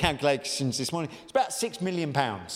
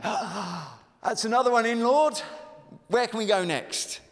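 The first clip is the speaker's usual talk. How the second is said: delighted; 5 semitones higher than usual. Retold or imitated.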